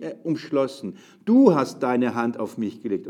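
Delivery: slow, loud, declaiming psalm; loud slow Bible reading in church; solemn scripture reading. Acted. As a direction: proclaim each line to the congregation. Reads the psalm aloud.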